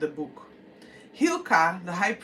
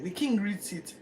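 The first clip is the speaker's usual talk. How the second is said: ordinary talk, nothing marked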